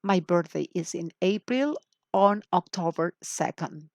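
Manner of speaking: slow, clear question and answer; careful classroom demo; slow model dialogue. Shown as a demonstration. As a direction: model it slowly for learners. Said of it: "otra frase como esta es".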